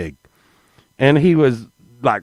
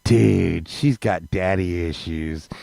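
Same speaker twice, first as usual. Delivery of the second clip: much lower and softer, slow; low sly aside; 9 semitones lower, 7 dB quieter, slower than usual. Retold or imitated. Telling homeboy the lady's secret, low and sly. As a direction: slip it to your buddy under your breath. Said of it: "I looked right at homeboy and I was like".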